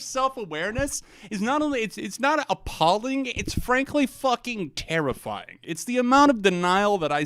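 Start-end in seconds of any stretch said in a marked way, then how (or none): none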